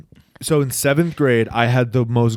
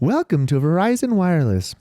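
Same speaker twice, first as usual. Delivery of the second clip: higher, singsong recorded-operator voice; mock phone-company announcement; automated phone greeting; 5 semitones higher than usual. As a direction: imitate a carrier's recorded message. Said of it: "and then Chris' phone goes".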